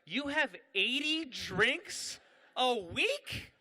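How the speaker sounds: slow, high, loud disbelief; acted-out incredulous question; shocked doctor. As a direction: stretch the question out, aghast. Doctor shocked by patient's answer.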